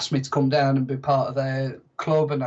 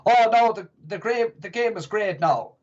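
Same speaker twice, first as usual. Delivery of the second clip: mock Irish accent; 5 semitones higher than usual. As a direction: put on a jokey Irish lilt as a footballer. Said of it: "accent that I gave him".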